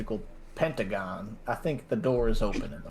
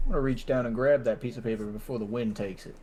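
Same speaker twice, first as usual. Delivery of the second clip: ordinary talk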